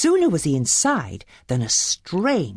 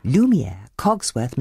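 ordinary delivery

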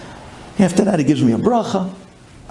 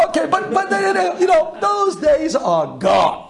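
mock lament; 12 semitones higher than usual. Mock lament over exchange rate.

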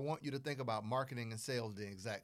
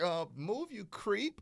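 higher-pitched put-on brush-off; mock rejection; 8 semitones higher, slower than usual. Woman turning down a day-one marriage proposal, mock rejection. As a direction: act out a woman rebuffing a creep.

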